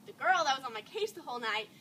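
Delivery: ordinary delivery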